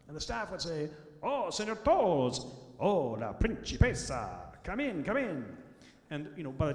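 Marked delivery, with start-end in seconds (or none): 1.2-2.5 slow, grand Italian waiter welcome; Italian accent. Retold or imitated. Restaurant staff greeting the kids. 2.8-4.5 put-on la principessa sung out slowly in an Italian accent. Staff greeting the daughter. 4.6-5.6 slow, grand Italian waiter welcome; Italian accent. Retold or imitated. Restaurant staff greeting the kids.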